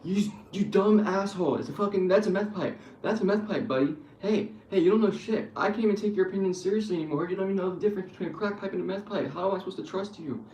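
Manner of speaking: mock-furious scolding of a know-it-all streamer; angry rant. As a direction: chew him out like he's clueless. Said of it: "reach through the fucking internet and say".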